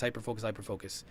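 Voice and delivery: normal talking voice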